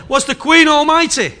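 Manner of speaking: loud, fervent preaching read of scripture; preaching at full force. Acted. Preacher reading Isaiah to the church, loud and fervent. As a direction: proclaim it to the whole congregation.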